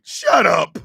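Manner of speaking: usual speaking voice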